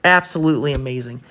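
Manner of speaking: ordinary talk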